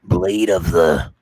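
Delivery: slow, deep trailer voice; movie announcer voice. Pretend. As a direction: growl it like a film trailer. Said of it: "No more movie announcer voice for me".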